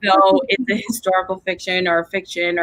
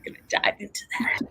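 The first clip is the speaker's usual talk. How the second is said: whisper; 9 dB quieter, faster than usual. Real, not acted. Excited aside about the new season.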